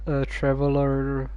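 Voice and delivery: normal talking voice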